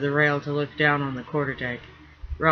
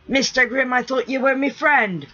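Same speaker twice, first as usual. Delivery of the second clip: higher, put-on rough sailor voice, hurt; wounded pirate rigger; 9 semitones higher, 4 dB louder than usual. Rigger protesting to his friend about the bath, aggrieved. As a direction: plead like a betrayed old salt.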